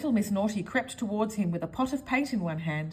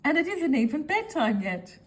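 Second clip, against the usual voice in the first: higher, delighted mischief; gleeful; 7 semitones higher, 3 dB louder than usual. A narrator's reading. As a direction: savour the naughtiness. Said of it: "giggled little Miss Naughty".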